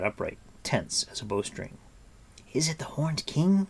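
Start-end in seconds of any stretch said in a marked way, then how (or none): 2.5-3.7 higher-pitched anxious question; urgent cry. A narrator's reading. Taran to Gwydion on hearing the baying, urgent cry. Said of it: "cried Taran".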